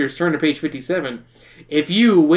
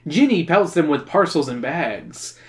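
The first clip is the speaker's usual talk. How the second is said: ordinary delivery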